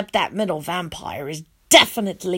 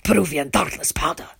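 low whisper in the ear; 5 semitones lower, 3 dB quieter than usual. A narrator's reading. Rescuer whispering in the boy's ear. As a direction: breathe it close. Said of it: "the man whispers in my ear".

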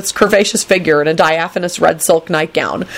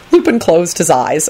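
plain reading voice

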